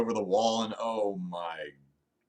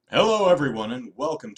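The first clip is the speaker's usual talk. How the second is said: bright, much louder and higher hello; excited greeting; 5 semitones higher, 10 dB louder than usual. Real, not acted. Host greets live viewers with a big, loud hello. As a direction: throw a big welcome to the viewers.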